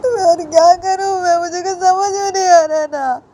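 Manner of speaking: loud, high-pitched tearful wail; acted crying. A narrator's reading. Student wails to his teacher that he understands nothing, crying. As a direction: cry out helplessly in a high voice. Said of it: "समर मुझे रोते होते ऐसे बोलता है".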